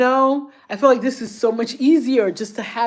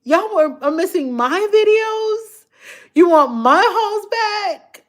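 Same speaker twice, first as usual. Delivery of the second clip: wondering aloud; 10 semitones higher than usual. Retold or imitated. Doubting herself aloud over fans missing her videos, high and plaintive. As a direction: voice climbing high, worried and unsure. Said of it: "I'd be like".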